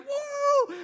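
high, whooping cheer of a road-tripper; excited whoop. Retold or imitated. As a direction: let out a big joyful woo. Retelling the excited road trip.

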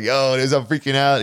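a bit wound up telling the wife; mild mock panic told to the wife; freaking out. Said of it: "telling my wife".

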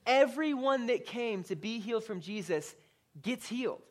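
ordinary talk, nothing marked

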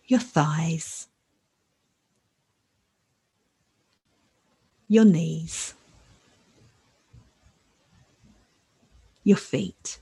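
calm and unhurried, long pauses; guided meditation. Acted. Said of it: "So now I'd like to lead us in a short new moon meditation".

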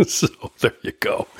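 said through laughter; laughing. Real, not acted. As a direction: laugh the words out. Laughing at the threat.

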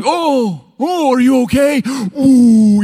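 high, loud overdone concern; hammed-up worried bystander; mock fussing onlooker. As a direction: gush over someone who tripped. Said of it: "When I, you know, like".

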